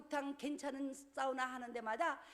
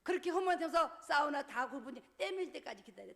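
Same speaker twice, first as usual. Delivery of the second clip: normal talking voice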